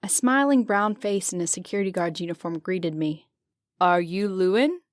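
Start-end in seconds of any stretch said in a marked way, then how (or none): 3.8-4.8 put-on light Italian accent question; a hint of an Italian guard asking. Guard greets the narrator in Italian accent. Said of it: "he said in a heavy Italian accent".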